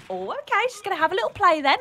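high, breezy mum impression; a lightly high chirpy mum voice. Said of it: "she just went".

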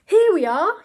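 high, loud, merry announcement. A narrator's reading. Bernard showing guests to their rooms, crying gaily. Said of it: "he cried gaily".

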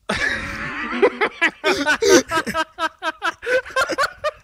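long high monkey-like cackle; monkey-noise laugh. Real, not acted. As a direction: laugh helplessly like a screeching monkey. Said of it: "what was that monkey noise you made".

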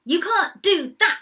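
loud, high scolding shout; storybook cross lady yelling. A narrator's reading. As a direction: shout to stop them, indignant. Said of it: "shouted a cross-looking lady".

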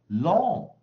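"long" drawn out slowly and loudly; term stretched out. Shown as a demonstration. Teacher naming a phonics term for students, long drawn out. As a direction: stretch the word long right out. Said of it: "它有long".

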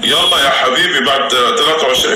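ordinary delivery

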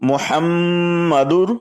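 মুহাম্মাদুর with the ম্ম hummed through the nose; nasal ghunna. Shown as a demonstration. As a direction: hold the doubled m in মুহাম্মাদুর nasally. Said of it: "এটা গুণনা হবে". (মুহাম্মাদুর গুণনা দিয়ে নাক দিয়ে উচ্চারণ)

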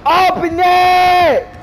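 high, drawn-out scream; frantic, stretched shriek of a demand; desperate shout. Real, not acted. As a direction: yell it long and loud. Desperate to get gate open.

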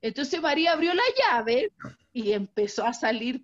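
ordinary delivery

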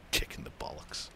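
soft, breath-only aside; whispered brawl quote. Acted. Player quoting a film bar brawl, whispered.

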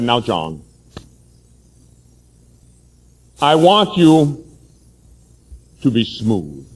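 slow, measured counsel with long pauses; bishop's advice. Retold or imitated. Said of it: "one time a bishop said".

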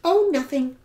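high, sing-song denial; sly innocent rat. Acted. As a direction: play innocent, squeaky. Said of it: "said the rat".

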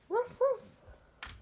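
high little ruff ruff; the dog answering, barking high. Acted. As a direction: yap like an eager puppy.

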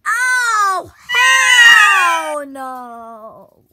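loud, high panic; alarmed shout. Acted. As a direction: yell it in alarm.

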